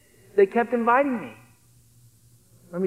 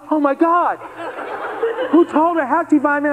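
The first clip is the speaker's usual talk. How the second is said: panicked inner thought; 8 semitones higher than usual. Retold or imitated. Son caught by his mother, in shocked alarm. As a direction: blurt out your shocked thoughts.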